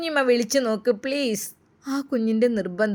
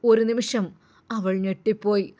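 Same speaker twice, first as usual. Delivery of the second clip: ordinary delivery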